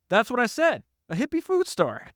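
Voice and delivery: very high, hammed-up exasperation; exasperated retort. [name] refusing John's correction, mock exasperated. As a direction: throw it back at him, voice way up. Acted quarrel over the store.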